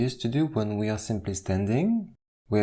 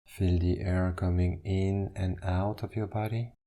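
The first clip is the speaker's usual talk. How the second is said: guided meditation. Acted. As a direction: ease them into stillness, one instruction at a time. Leads a standing meditation.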